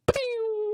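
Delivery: high mock gunshot "pew"; movie gun ricochet. Shown as a demonstration. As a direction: fire off a cartoon ricochet "pew". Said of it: "it always makes this one".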